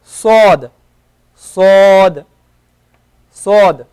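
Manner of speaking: emphatic Arabic sad, said loudly. Shown as a demonstration. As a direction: push out a heavy, hollow s. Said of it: "This one is".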